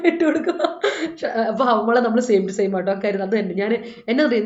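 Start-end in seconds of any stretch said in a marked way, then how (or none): 0.0-0.6 laughing through the words, higher and louder. Real, not acted. Telling the teacher about her driving craze, laughing. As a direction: laugh it out as you finish.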